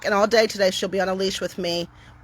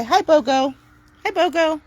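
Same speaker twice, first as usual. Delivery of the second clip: higher, cheery doggy hello; very upbeat voice; 8 semitones higher, 3 dB louder than usual. Real, not acted. Greeting Bogo so Timber hears calm cheer, upbeat. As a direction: sound happy so the dog hears it's fine. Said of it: "I have a very upbeat voice".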